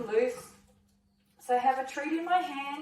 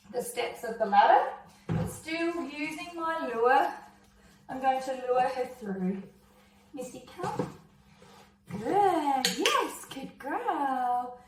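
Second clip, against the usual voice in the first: usual speaking voice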